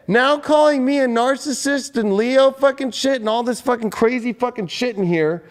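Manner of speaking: normal talking voice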